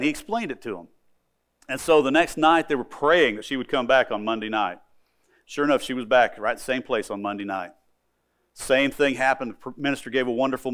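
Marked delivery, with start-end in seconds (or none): none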